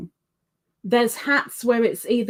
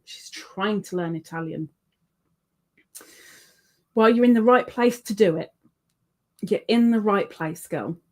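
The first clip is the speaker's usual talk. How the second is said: ordinary talk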